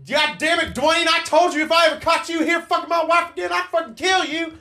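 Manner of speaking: high, strained hollered threat. Acted. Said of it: "But he yells back".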